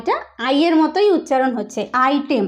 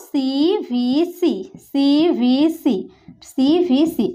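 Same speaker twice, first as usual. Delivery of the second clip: slow drilling; much slower than usual. Shown as a demonstration. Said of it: "drilling the pattern".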